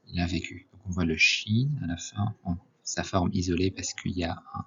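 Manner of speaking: normal talking voice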